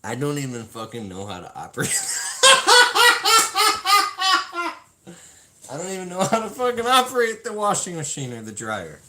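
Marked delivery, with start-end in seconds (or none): 2.0-4.8 high burst of laughter; loud embarrassed laugh. Real, not acted. Laughing at his own confession. 5.7-9.0 higher, louder, talked through laughter; laughing confession. Real, not acted.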